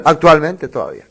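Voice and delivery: ordinary talk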